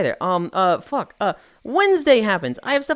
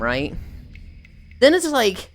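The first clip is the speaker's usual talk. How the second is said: ordinary delivery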